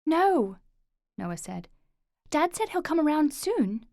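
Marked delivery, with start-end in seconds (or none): 0.1-0.6 high, loud no; firm refusal. Acted. Boy stopping his uncle from calling, high loud no. 2.3-3.9 put-on higher, louder boy's voice; child voice. Boy insisting to his uncle, higher child voice.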